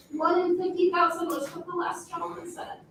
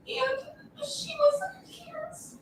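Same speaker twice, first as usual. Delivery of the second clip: tearful; 9 semitones higher, 4 dB quieter, slower than usual. Real, not acted. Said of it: "it gets tearful".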